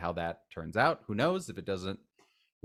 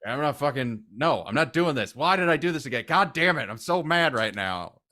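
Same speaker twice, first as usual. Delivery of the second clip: higher, louder, grumbling panic as Earl; mock-furious grumpy outburst, higher and louder; imitating panicky anger; 6 semitones higher, 8 dB louder than usual. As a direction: play Earl losing it, half-hearted. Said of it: "his character of just, like".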